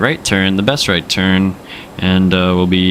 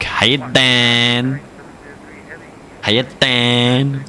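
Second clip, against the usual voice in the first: higher, stretched New York greeting; New York TikTok comedian; 4 semitones higher, much slower than usual. Retold or imitated. Streamer imitating TikTok street comic, New York accent. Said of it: "the one guy on TikTok who, like, walks around the New York City streets".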